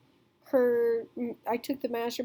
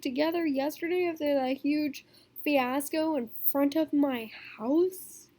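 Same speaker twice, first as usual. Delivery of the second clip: higher, incredulous questioning; confused outburst; 4 semitones higher than usual. Retold or imitated. Told a friend's sister is missing, higher and incredulous. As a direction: repeat it in disbelief, pitch up. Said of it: "I was so confused".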